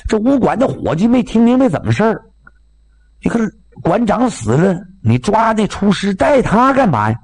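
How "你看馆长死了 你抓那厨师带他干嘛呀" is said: ordinary delivery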